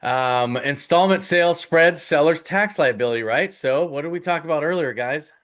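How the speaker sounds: ordinary talk, nothing marked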